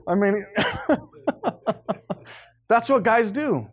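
ordinary delivery

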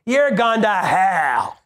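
slow, drawn-out mock damnation; mock unloving condemnation. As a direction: draw it out like an unloving hellfire preacher. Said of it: "You tell them as lovingly as you can. You don't go".

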